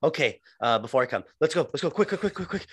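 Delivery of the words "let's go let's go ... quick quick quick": rising, rushed quick quick quick; mock hurried urgency. Joking host hurrying through sex bit, mock rushed. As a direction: hurry it up in a playful, breathless panic.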